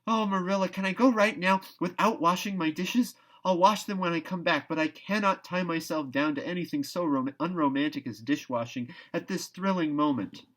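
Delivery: put-on high, eager, rushing girl's voice; a thrilled girl begging to go at once; breathless excitement. A narrator's reading. Anne begs Marilla to let her go, high and thrilled. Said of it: "so uplifted and aerial was Anne's expression".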